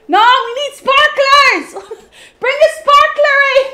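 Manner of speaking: high, loud celebratory demand with laughter; gleeful shouting. Real, not acted. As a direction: yell for it in giddy triumph.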